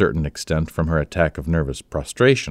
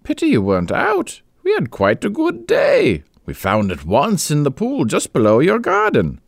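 much higher, brisk and gushing lady; chatty hunting neighbour; 8 semitones higher than usual. A narrator's reading. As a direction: chatter breezily about the day's hunt. Aurora voiced, chatty neighbour.

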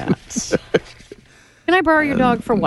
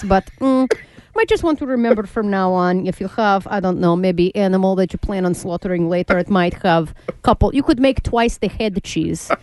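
put-on lightly Russian-accented TV news anchor; halting foreign newsreader, mock-calm. Continues Russian newscaster bit.

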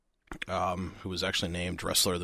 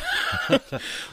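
high breathy laugh; 5 dB louder than usual. Real, not acted. Laughing about the freshman.

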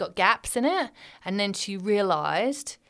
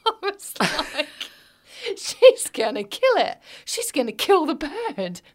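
higher, laughing alarm; laughing panic; 7 semitones higher than usual. Real, not acted. Telling friend her child grabbed a bird, laughing panic. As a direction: laugh it out in mock horror.

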